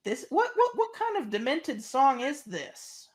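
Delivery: high incredulous rising cry; slow and laughing incredulity; aghast. Retold or imitated. Baffled by a creepy track, high and laughing. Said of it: "I'm like".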